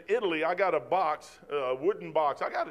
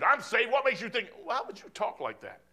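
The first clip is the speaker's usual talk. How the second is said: barking reply; 5 semitones higher than usual. Retold or imitated. Mimicking a churchgoer snapping back at a question about salvation. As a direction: bark it back at the asker. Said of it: "they just bark at you".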